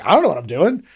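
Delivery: retelling a defensive confession, pitch jumping up; hammed-up plea of being out of depth; flustered admission. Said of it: "Willem Dafoe just admits".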